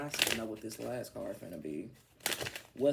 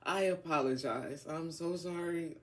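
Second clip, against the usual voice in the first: usual speaking voice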